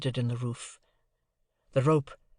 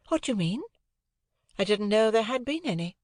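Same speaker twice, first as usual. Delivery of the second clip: put-on lighter, higher young lady's voice; cool, airy society girl; a lightly raised ladylike voice; Estella; 9 semitones higher than usual.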